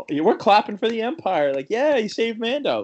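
high, loud, far-off crowd voice cheering the Empire; mock cheering crowd. Fans cheering for the Empire on screen, high excited cheer. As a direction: whoop it up like a giddy audience.

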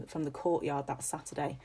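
ordinary delivery

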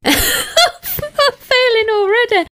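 slow words through a laugh. Real, not acted. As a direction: laugh while admitting defeat. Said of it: "laughing at the quiz".

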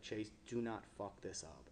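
low, hushed, grave warning; dead serious. Retold or imitated. Partners warning Chase late at night, dead serious. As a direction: warn him very seriously under your breath. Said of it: "look at me so seriously".

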